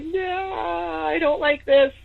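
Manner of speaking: high, drawn-out whine of dread; uneasy high-pitched refusal. Retold or imitated. Reacting to the key in her purse, high uneasy refusal. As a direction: stretch it out high, backing away. Uneasy recoil at creepy events.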